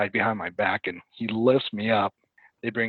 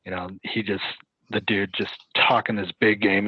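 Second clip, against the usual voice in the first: ordinary talk, nothing marked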